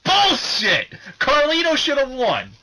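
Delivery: high, loud, laughing outrage; heated rant. Real, not acted. Wrestling fan venting to co-hosts, loud high outrage. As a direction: vent like a wronged fan. Ranting about Carlito's loss.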